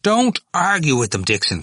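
high, forceful order; brutal. A narrator's reading. Cranley ordering Dixon not to argue, brutal. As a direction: cut in harshly, hitting the first words. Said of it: "Cranley said brutally".

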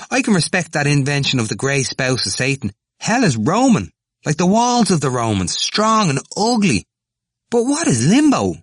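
impassioned tirade. A narrator's reading. Temple haranguing the students on hell, impassioned. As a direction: climb in pitch and force to the final question.

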